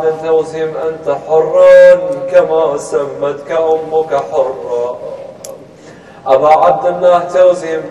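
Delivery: loud chanted storytelling with long-held syllables; declaimed sing-song lament, swelling in force; lamentation recital. A narrator's reading. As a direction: intone the tale slowly, stretching the line endings.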